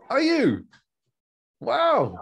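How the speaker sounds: high, loud amazed exclaim; genuine surprise. Real, not acted. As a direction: let it jump up in real wonder. Guest says she's in Oxford.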